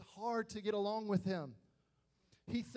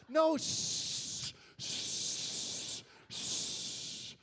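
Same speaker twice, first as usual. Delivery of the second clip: frantic whispered hush; 8 semitones higher, much slower than usual. Retold or imitated. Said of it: "Eric is like".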